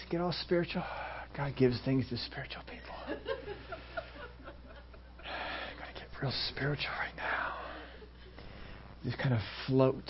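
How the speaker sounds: soft, breathy mock-holy murmur, nearly whispered; mock spiritual hush. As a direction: tease the overly spiritual by going hushed and dreamy. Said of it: "No, you don't have to do that".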